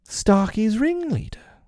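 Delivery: high, slow mock-pleasant menace; silky accusation. A narrator's reading. Pirate captain naming the mutineer, silky menace. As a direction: draw it out high and sweet.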